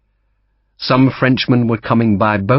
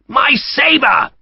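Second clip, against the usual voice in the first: protesting. A narrator's reading. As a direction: wail for the lost sabre. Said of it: "the Brigadier protested".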